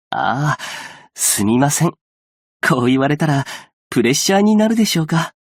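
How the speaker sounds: ordinary delivery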